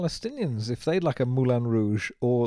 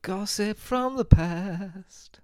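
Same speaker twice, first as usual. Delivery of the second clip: very slow, higher mock segment title; drawn-out jingle title; 7 semitones higher, much slower than usual. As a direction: stretch it out like a show title. Echoes mock segment title, slowly.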